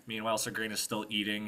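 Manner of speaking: ordinary delivery, nothing marked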